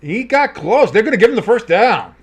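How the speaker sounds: high, loud big-play call; excited play-by-play. Real, not acted. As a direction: belt out the first down. Live call of big catch, flag.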